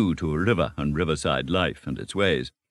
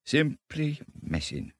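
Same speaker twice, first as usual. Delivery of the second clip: slow, softer, high-floating voice; dreamy; 10 semitones higher, 6 dB quieter, slower than usual. A narrator's reading. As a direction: drift off into a reverie. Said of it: "he went on dreamily".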